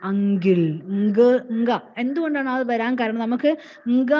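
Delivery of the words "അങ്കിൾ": "അങ്കിൾ" said as ang-gil; a G sound in place of K in "അങ്കിൾ"; Malayali mispronunciation of uncle. Shown as a demonstration. Teacher shows how Malayalis mispronounce it, ang-gil for "അങ്കിൾ". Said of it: "മലയാളികൾ അത് മിസ്പ്രൊനൗൺസ് ചെയ്യുന്നത് എങ്ങനെയാണ്".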